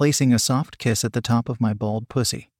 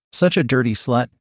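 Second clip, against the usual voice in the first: plain reading voice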